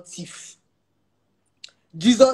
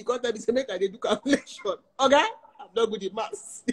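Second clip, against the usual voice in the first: laughing; 5 semitones higher, 3 dB louder than usual. Real, not acted. Laughing at being asked maths.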